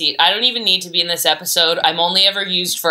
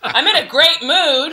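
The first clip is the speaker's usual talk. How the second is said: sarcastic; 8 semitones higher, slower than usual. Acted. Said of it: "sarcastic reversal".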